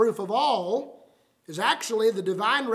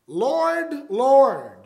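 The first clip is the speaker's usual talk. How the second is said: "Lord" rising high, then a louder "Lord" falling; emphatic Lord Lord; 4 semitones higher, 8 dB louder than usual. Retold or imitated. Preacher voicing the crowd at judgment, emphatic. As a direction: plead "Lord, Lord" with weight on each word. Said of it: "is an emphatic way".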